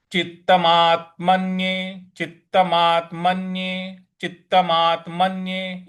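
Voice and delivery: चित्तमात्मन्ये said with a held त्त; careful recitation. Shown as a demonstration. Teacher drilling the class on चित्तमात्मन्ये. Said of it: "इस प्रकार से बोलना है".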